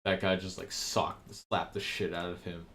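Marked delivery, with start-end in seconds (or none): none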